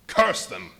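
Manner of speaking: loud, spat-out curse; angry curse. Acted. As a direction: lash out at the crowd. Fugitive cursing the townsfolk.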